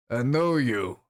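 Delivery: lightly voiced old troll; a hint of a weary troll's voice; troll character. Troll recognising the visitor, lightly voiced.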